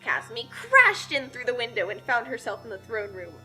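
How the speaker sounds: plain reading voice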